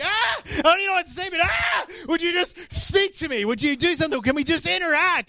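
high, eager cry to God; eager worshipper. Pretend. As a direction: burst out like a child begging for attention. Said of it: "we're like".

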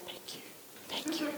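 barely audible, almost mouthed; hushed thanks. Retold or imitated. Imitating relieved passengers thanking her for the baby, hushed. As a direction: imitate grateful onlookers under your breath.

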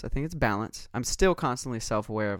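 ordinary delivery

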